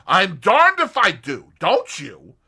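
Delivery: loud, emphatic, fist on the table; exasperated American. A narrator's reading. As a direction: bang it out in frustration. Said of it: "brought down his fist with a bang".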